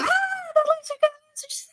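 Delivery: very high, soft laughing squeak; very high breathy laughing squeak; laughing squeal. Real, not acted. Laughing mock triumph.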